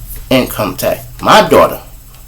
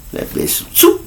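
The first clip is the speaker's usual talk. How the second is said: ordinary delivery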